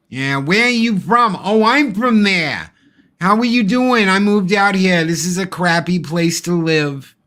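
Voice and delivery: put-on high, loud chatty old lady. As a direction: play the nosy old neighbour brightly. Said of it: "That was good".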